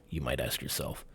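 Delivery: ordinary talk, nothing marked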